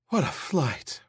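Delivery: out of breath, airy and rushed; breathless. Acted. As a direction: gasp the words like you just ran in. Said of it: "Rachel Rosen said breathlessly".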